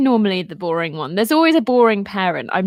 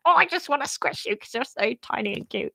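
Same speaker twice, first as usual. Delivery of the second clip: squishy cute voice; 3 semitones higher, 6 dB quieter than usual. Retold or imitated. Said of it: "really excited and you're just like".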